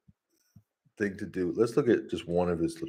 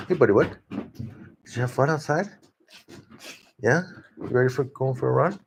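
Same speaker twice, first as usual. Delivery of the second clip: higher, sing-song pet talk to a dog; 5 semitones higher than usual. Real, not acted. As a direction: coo at your buddy the dog as he comes in. Talking to the dog.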